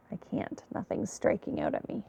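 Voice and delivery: whispered aside to herself; whispering. Real, not acted. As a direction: drop to a breathy whisper. Medium muttering while searching.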